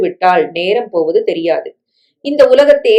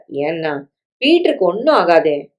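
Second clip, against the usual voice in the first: usual speaking voice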